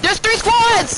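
slow high alarmed shout. Real, not acted. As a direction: warn them in alarm. Surprised by three squads.

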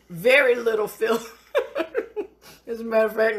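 words broken up by real laughter; laughing. Real, not acted. Praising the crab cakes to viewers while laughing.

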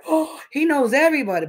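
high, wide-eyed awe; young and impressed. Retold or imitated. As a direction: say it like a dazzled kid. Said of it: "I was like".

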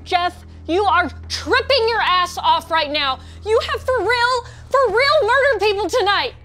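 loud, rising shout of disbelief; alarmed confrontation. Acted. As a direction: drive each word home loudly. Confronts friend over real murders.

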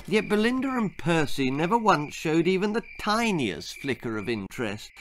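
ordinary delivery

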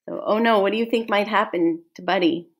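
ordinary delivery, nothing marked

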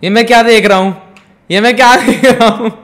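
high, loud laughing what-am-I-seeing; laughing disbelief. Real, not acted. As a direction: crack up at the replay. (हँसते हुए ऊँची आवाज़ में हैरानी)

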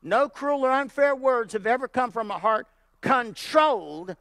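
loud, driving preacher cadence; preaching at full force. Acted. Preacher drives it home to the congregation, loud and driving. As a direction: hammer the point home to the pews.

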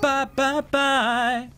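squeaky high singsong goodbye; high mocking bye. Real, not acted. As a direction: tease them in a high voice. Echoing the taunt.

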